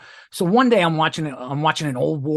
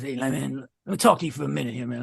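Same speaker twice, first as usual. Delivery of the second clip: imitating old inmate; 3 dB quieter than usual. Old man rolls up at the bunk for a word, imitated talk. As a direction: imitate his talk, soft and hurried. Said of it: "that's how he talked".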